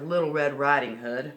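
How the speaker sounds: usual speaking voice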